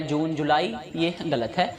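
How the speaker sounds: ordinary delivery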